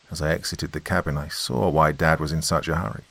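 ordinary delivery, nothing marked

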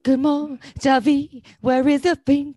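high, lilting fairy-tale heroine impression; Belle. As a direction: chirp it like a storybook village girl. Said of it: "Yeah, Belle".

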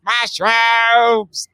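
high chipmunk-style monster call. Retold or imitated. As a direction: squeak it out like a chipmunk. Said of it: "but a very chipmunky way".

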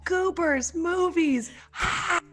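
high, thrilled mock celebration; excited. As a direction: squeal it like the crowd is going wild. Imagined crowd scene, excited.

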